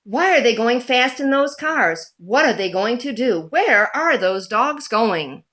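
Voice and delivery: ordinary delivery, nothing marked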